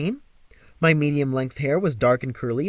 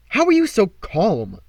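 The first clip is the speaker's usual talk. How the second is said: demanding; 5 semitones higher, 4 dB louder than usual. Acted. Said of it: "I demanded".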